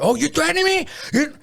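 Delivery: high, taunting guard voice; mocking guard. The guard taunts the inmate before hitting him, high and sneering. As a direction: challenge him like a guard spoiling for a fight. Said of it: "the guard's yelling in his face".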